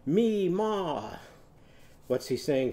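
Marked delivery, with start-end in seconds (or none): 0.1-1.2 high, stretched cowboy call; cowboy whoop. Acted. Cowboy calling for Mimi Mermaid, high whoop. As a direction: sing out "Me, ma" like a yee-haw. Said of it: "what's our cowboy saying for Mimi Mermaid".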